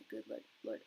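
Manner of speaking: voiceless murmur under the breath; whispered while typing. Real, not acted. Reading aloud what she types to the viewer, voiceless. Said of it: "I was typing".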